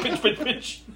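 soft, giggly little chant; laughing whisper. Retold or imitated. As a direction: chant it softly through a laugh. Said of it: "He was like".

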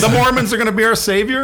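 high, loud question through laughter; laughing disbelief. Real, not acted.

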